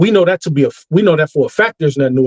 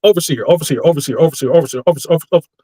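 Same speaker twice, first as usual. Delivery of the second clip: speeding up; much faster than usual. Shown as a demonstration. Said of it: "say it faster and faster and faster".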